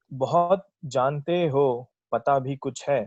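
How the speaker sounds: slow, measured verse delivery; ghazal recitation. Acted. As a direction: recite it like a poet at a mushaira. Recites own ghazal at mushaira.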